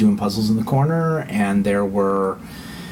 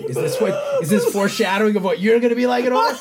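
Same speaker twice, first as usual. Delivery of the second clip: laughing wife; 12 semitones higher than usual. Retold or imitated. Wife's shocked quote, laughing.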